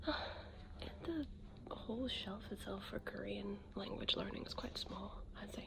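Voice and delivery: hushed, let-down whisper; disappointed whisper. Real, not acted. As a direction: whisper the bad news. Whispering in the shop.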